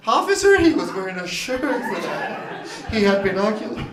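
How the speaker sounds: a mildly acted frightened report, high on Officer; a hint of a scared woman calling the police; girl reporting him to police. Girl describing him to an officer, lightly mock frightened. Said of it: "This is how she would describe me".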